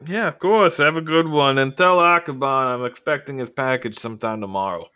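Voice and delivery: high, loud character voice; big voice, easing slightly toward the end; Faust, breezy send-off. Acted.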